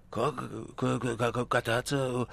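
halting, stuttered question; flustered, softly spluttered disbelief; baffled stammer. Acted. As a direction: stumble over the word in confusion. Stammering at baffling phone news.

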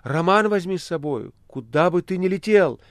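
high, insistent exclamation; urgent cry. A narrator's reading. Margarita urges the Master to take the novel, crying out. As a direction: plead at a high pitch. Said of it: "кричала она мастеру".

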